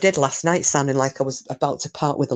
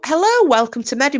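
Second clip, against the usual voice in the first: high, lively mock host greeting; lightly acted, high-pitched hello; acted stream greeting; 10 semitones higher, 4 dB louder than usual. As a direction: greet newcomers like an eager host. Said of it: "I go".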